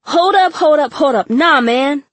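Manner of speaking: high, loud yell over the band; dad shouting to stop the music. A narrator's reading. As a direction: call a halt over the drums. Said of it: "Dad yelled over his conga".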